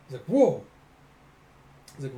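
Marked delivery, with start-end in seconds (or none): 0.2-0.6 high, loud mock whoa; startled yelp. Onlooker startled by a zap, loud acted yelp. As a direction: jump back vocally, acting startled.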